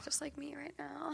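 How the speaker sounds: breathy whispered aside. Real, not acted. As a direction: drop to a whisper for the joke. Joking aside about crying.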